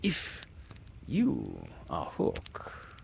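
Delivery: slow, halting, ingratiating; almost humble. A narrator's reading. Hook trying to win over the voice, humble. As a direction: coax carefully, almost humbly. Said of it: "he said almost humbly".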